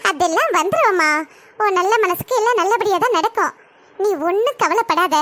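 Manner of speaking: calm her worries like an aunt; reassuring elder woman. Acted. Elder woman promising the bride she'll come, low and reassuring.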